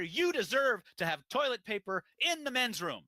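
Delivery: out-rage the angry customer on his side; indignant shout for the customer; matching his anger. Retold or imitated. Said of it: "align with the energy".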